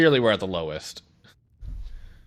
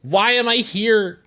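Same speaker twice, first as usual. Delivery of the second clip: high whine; 12 semitones higher, 5 dB louder, slower than usual. Pretend.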